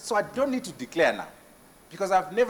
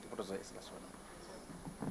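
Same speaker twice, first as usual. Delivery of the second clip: hushed whisper off to the side; quick whispered check with the host; whispered aside; 10 semitones lower, 26 dB quieter, slower than usual. Real, not acted. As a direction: whisper the question under your breath. Aside asking for the next question.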